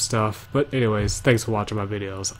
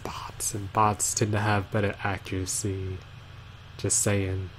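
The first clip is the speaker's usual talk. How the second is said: whisper; 2 semitones lower, 8 dB quieter than usual. Real, not acted. Aside excuse about bots.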